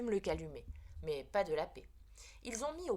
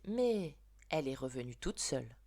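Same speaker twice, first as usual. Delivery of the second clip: ordinary delivery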